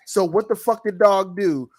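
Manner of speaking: ordinary delivery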